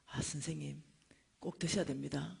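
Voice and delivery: low, soft polite coaxing; genteel dad. Retold or imitated. Dad urging a drunk man to eat, gently and politely. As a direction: imitate a mild, well-mannered gentleman. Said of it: "우리 아빠는 굉장히 점잖은 분이거든요".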